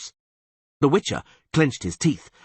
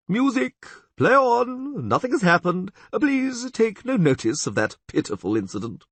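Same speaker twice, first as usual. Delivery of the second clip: flustered chamberlain; 12 semitones higher than usual. A narrator's reading. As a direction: hurry to smooth things over. Chamberlain calms the ballroom.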